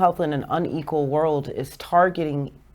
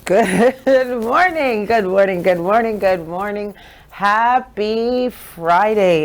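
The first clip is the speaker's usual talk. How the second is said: drawn-out, loud, high hello to listeners; big radio greeting; 5 semitones higher, 7 dB louder, slower than usual. Real, not acted. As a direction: stretch the good morning like a morning show host.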